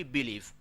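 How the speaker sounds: BE-lieve with a falling second syllable; stress shifted to first syllable. Shown as a demonstration. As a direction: lean on BE in "believe". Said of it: "put the stress on B".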